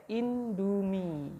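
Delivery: Indomie drawn out slowly; teacher showing how Indomie is read; slow reading demo. Shown as a demonstration. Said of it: "Ini bacanya apa ya?".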